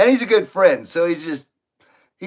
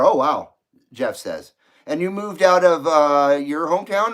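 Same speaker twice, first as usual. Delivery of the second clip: ordinary delivery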